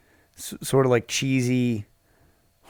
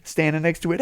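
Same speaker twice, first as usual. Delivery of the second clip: ordinary talk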